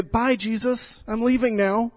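a child waving up at the roof, gently imitated; lightly voiced little girl. Little daughter talking to nativity.

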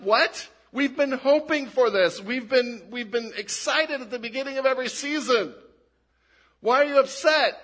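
incredulous fan protesting, voice up; indignant disbelief. Pretend.

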